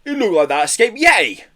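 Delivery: high, loud, amazed; gasped astonishment. A narrator's reading. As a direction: report the sighting in shock. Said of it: "gasped Blister".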